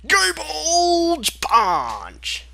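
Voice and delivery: very high, slow, dragged-out battle cry; mock attack yell. Reader hamming up an attack name, high, slow and loud. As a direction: ham it up like an anime attack.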